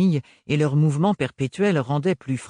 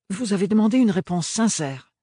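put-on earnest young woman; 8 semitones higher, 4 dB louder than usual. Apprentice replies.

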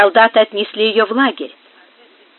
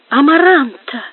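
higher, startled cry of recognition; involuntary exclamation; 5 semitones higher, 4 dB louder than usual. A narrator's reading. Prince glimpsing his dead lover, an involuntary cry. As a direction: let the name burst out in shock. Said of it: "невольно воскликнул принц".

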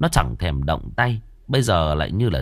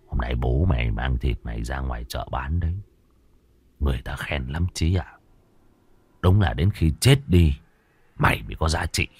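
low, menacing husband taunting the dead; lightly voiced murderer, low and mocking; gloating killer; 6 semitones lower than usual. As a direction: sneer it out low and cold. Narrator voices killer Sơn.

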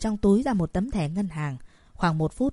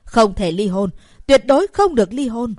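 hurried shout; 3 semitones higher, 8 dB louder than usual. Acted. Mother shouting at her daughter, frantic and loud. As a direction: yell it in panic to stop the divorce. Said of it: "vội vàng hét lên".

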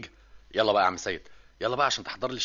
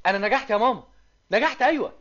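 joyful news; 6 semitones higher than usual. Acted. Son announces exam success on phone.

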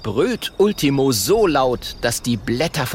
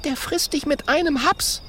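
put-on high girlish voice; 12 semitones higher than usual. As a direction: warn him sensibly. Mathilda voiced.

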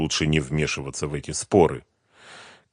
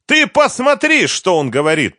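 high, loud cry of outrage; indignant scream; shouting on; 13 semitones higher, 8 dB louder than usual. A narrator's reading. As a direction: yell it, calling others to witness. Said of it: "продолжала кричать Лиза".

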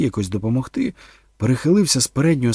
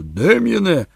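put-on loud, raised shout at the boy; thundering rebuke; 8 semitones higher, 6 dB louder, slower than usual. A narrator's reading. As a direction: roar at the child to stop. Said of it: "гримнув на хлопчика Торн". (гнівний окрик)

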